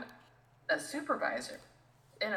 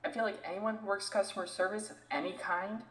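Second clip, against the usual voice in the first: normal talking voice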